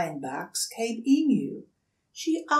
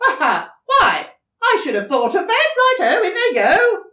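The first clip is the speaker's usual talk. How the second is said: much higher, loud, fast, laughing; delighted; 12 semitones higher, 12 dB louder, faster than usual. Acted. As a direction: burst out with glee. Dingo delighted.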